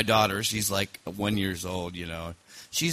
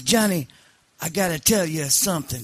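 raised-pitch mock Sicilian accent; Sicilian mob boss; 6 semitones higher than usual. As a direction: confide like an old Sicilian don. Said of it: "Sicilian mob boss once".

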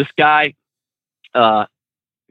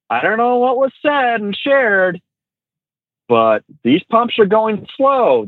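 slow, higher-pitched retold peacemaking; careful appeasing; 7 semitones higher, slower than usual. Trainer placating an armed stranger at the fuel pump, careful. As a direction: tread carefully, stretching out each phrase.

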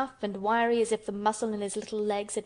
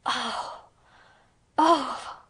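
high gasp of delight, building louder; delighted cry; 3 semitones higher, 4 dB louder than usual. A narrator's reading. Colin hears the creatures are coming, crying out delightedly. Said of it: "cried Colin out in delight".

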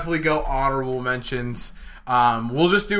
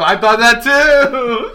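excited; 10 semitones higher, 7 dB louder, slower than usual. Real, not acted. Repeats it excitedly.

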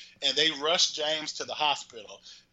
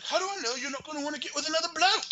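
high, fast put-on voice rising at the end; mock indignant boyfriend; 14 semitones higher, faster than usual. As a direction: throw the question back, squeaky and quick. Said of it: "And he's like".

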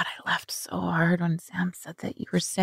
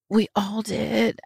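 ordinary delivery

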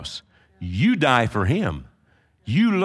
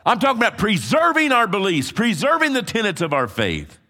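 raised, driving preacher's cadence; full-force preaching; 10 semitones higher, 4 dB louder than usual. Acted. Pastor rallying the congregation, preaching hard. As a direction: pound each phrase home from the pulpit.

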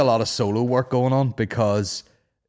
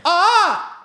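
loud echo test shout; 7 dB louder than usual. Real, not acted. Testing room echo.

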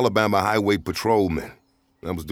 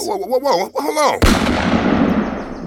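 high, loud startled whoas; alarmed protest; 13 semitones higher, 6 dB louder, much slower than usual. Acted. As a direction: try to halt someone threatening you. Startled by an armed threat.

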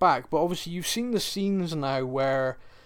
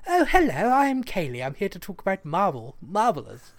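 a lightly mocked posh phone voice, higher at the start; Kaylee's phone voice; 4 semitones higher than usual. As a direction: play the friend putting on her best telephone manner, pitched up. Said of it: "biggest phone voice".

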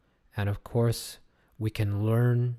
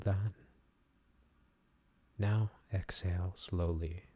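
soothing massage murmur; 4 dB quieter than usual. Acted. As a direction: guide them into calm, gently. Leads breathing meditation.

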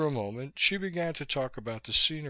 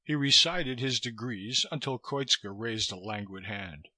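plain reading voice

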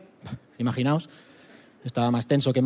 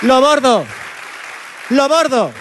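much higher, much louder boast; triumphant shout; 10 semitones higher, 6 dB louder, slower than usual. Pretend. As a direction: yell like you just nailed it. Proud boast of nailing it.

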